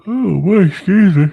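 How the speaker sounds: high, loud yawn through the words. Real, not acted. Tired host with no nap excusing himself, real yawn. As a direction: let a big sleepy yawn stretch the words. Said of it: "I ain't had no nap day".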